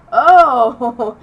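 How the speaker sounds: loud, high mock "oh" of realisation; retold surprised realisation. Teacher learning the student is a guy, surprised exclamation. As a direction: exclaim as if it has just dawned on you.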